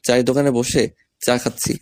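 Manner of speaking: ordinary talk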